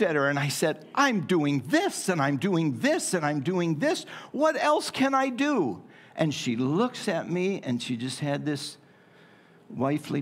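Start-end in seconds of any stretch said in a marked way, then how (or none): 0.9-5.8 retold protest, pitch leaping at the final question; mock exasperation. Retold argument with his wife.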